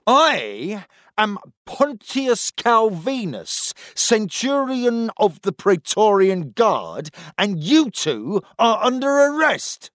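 high, slow proclamation; pompous officer. A narrator's reading. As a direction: announce it grandly. Centurion announces the arrest.

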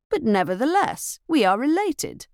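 a narrator's hint of a stern cousin laying down the law; lightly voiced stern reproof. A narrator's reading. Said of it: "the Marquis told her sternly".

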